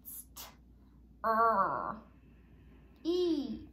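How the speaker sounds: "St" hissed, then "er", then "eat"; sounding out street. Shown as a demonstration. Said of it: "S, this one's a tricky one".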